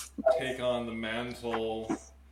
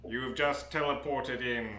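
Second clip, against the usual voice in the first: slow, dramatic scene-setting narration; game master narration; 8 dB quieter than usual. Acted.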